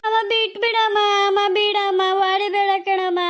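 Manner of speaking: whiny, drawn-out begging; wailing plea. Acted. Second child begs mother to stop, wailing plea. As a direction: plead like a child being punished.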